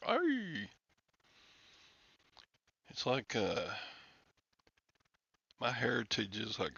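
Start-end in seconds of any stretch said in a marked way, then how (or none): 0.1-0.7 high, drawn-out mock-Irish cry; mock Irish accent. Asking whether anybody talks Irish, high mock-Irish lilt. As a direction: stretch it out in fake Irish. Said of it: "Can anybody talk Irish?".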